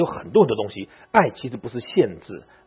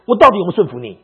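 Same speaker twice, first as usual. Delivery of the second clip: indignant demand; 6 semitones higher, 8 dB louder, faster than usual. Retold or imitated. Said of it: "他问他丈夫说".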